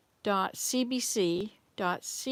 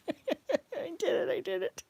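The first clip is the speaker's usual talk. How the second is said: excited laugh; 8 semitones higher, slower than usual. Real, not acted. Celebrates finishing something.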